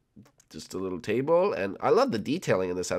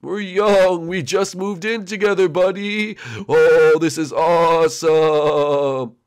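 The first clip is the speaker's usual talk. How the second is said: high, loud ghostly cheer; excited ghost friend; 5 semitones higher, 7 dB louder, slower than usual. Acted. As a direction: gush in a high cartoon ghost voice. Voices ghost Blimpy.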